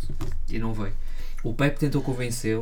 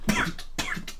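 very high, breathy cough-words; coughing out Porto; slower than usual. Acted. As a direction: disguise the word as a cough. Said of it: "a tossir".

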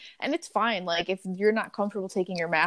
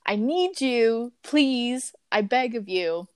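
slow, raised-pitch begging at a customer; exasperated plea; 3 semitones higher, slower than usual. Retold or imitated. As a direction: plead with strained patience, drawing out the words. Acted plea to phone customer.

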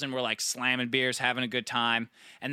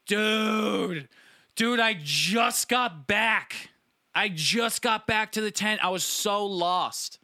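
higher, slow, lightly voiced lost buddy; a hint of a wasted, bewildered friend; dazed friend; 6 semitones higher, 3 dB louder, much slower than usual. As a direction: drag it out like a bleary friend who just got back. Friend stumbling back lost, voiced.

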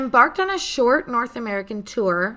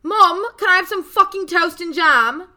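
loud, higher mock British accent; hammed-up British accent, higher and louder; 8 semitones higher, 7 dB louder than usual. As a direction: shout at mum in a put-on British accent.

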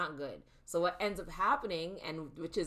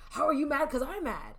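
loud, high, imitating an angry comeback; mock indignant; 8 semitones higher, 8 dB louder than usual. Wife acts out husband answering her anger, mock indignant. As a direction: throw the challenge back, pitched up and loud.

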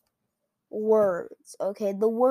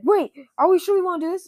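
high, fast, louder second thoughts; alarmed doubter; 9 semitones higher, 7 dB louder, faster than usual. Acted. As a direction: urgently halt everyone in a raised pitch. One councillor objects at last moment.

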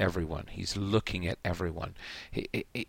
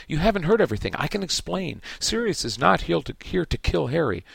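high, louder urgent pleading; urgent plea; 7 semitones higher, 3 dB louder than usual. A narrator's reading. Lupin urgently begs Snape to hear him out, higher and louder. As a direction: plead with him to listen, pitched up. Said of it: "said Lupin urgently".

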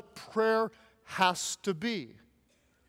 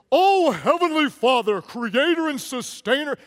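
prayer voice; 5 semitones higher, 6 dB louder than usual. Retold or imitated. Pastor mocking a friend's showy mealtime prayer voice. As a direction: ham up a pompous churchy prayer. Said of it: "they get prayer voice going".

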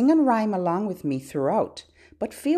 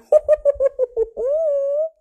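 very high, rippling giggly hoots; playful high hoot; 5 dB louder than usual. Pretend. Host playing tickle game with babies, high hooting. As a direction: let out squeaky tickled hoots.

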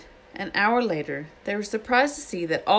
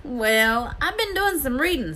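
Hagrid; 9 semitones higher than usual. A narrator's reading. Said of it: "said Hagrid".

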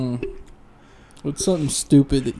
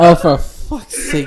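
laughing; 3 semitones higher, 8 dB louder, much slower than usual. Real, not acted. Reacting to the gag gift.

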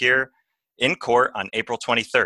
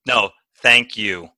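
usual speaking voice

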